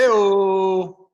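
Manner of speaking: loud cheeky hey-o; hey-o shout. Real, not acted. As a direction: call it out like a sidekick. Joke reaction shout.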